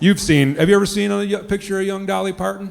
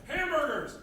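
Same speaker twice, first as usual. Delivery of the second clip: shrill alarm screech; 10 semitones higher, 15 dB quieter than usual. Pretend. Smoke detector alarm shriek.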